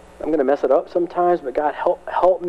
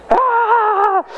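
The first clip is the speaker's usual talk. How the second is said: excited cry; 3 dB louder than usual. Real, not acted. Preacher's sudden outcry.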